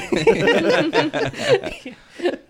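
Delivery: high, loud burst of laughter; real high laugh. Real, not acted. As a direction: crack up at the impression. Laughing at the impression.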